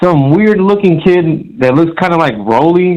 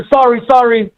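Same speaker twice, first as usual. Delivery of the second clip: high-pitched imitation of an apologetic kid; mock apology; 10 semitones higher than usual. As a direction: mimic a flustered apology in a raised voice. Retells kid's apology.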